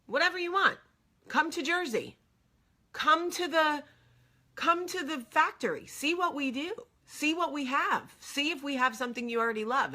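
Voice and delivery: high-pitched imitation of an eager host; insistent invitation. Beauty company owner urges her to visit, imitated high insistence. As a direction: pitch it high, urging in separate bursts.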